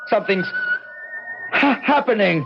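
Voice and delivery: high-pitched frightened pleading as he rises; a man terrified, begging his wife to hold him down; panicked. Acted. Adam levitating helplessly, calling wife.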